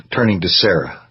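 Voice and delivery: ordinary delivery, nothing marked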